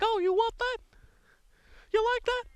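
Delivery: a bad falsetto Mickey taunt; terrible Mickey Mouse. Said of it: "terrible Mickey Mouse impression".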